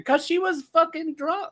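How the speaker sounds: high-pitched exclamation. Real, not acted. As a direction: blurt the answer in a high pitch. Mock-answering a live commenter's question.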